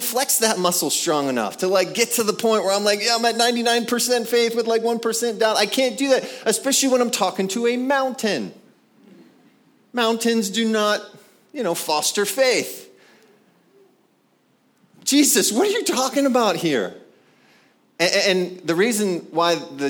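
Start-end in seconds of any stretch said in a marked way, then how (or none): 3.0-8.5 frustrated. Retold or imitated. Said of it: "was so frustrating for so many years". 15.0-16.9 high-pitched, baffled complaint to Jesus; retold exasperation, pitched high; exasperated. Acts out his frustration at the verse.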